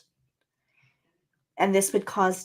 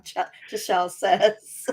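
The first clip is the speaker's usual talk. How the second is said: usual speaking voice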